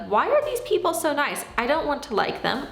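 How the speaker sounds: high, huffy mock exasperation; irritated inner voice. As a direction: play the annoyed skeptic who resents kindness. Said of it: "I can remember being so irritated".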